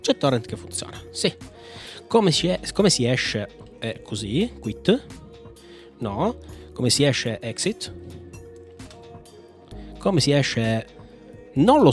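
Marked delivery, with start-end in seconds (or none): none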